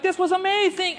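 high-pitched imitation of delighted fans; amazed delight. Other attendees praise the conference, amazed delight.

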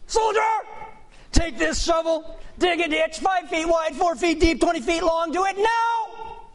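barking high drill sergeant orders. Retold or imitated. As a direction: bark it like a mean drill sergeant. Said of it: "I don't do a very good drill sergeant impression".

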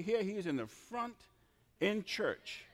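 usual speaking voice

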